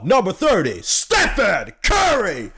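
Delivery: imitated home-team introduction, name drawn out; pumped-up announcer. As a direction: build to a slow, hyped shout of the name. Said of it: "De är så jävla taggade".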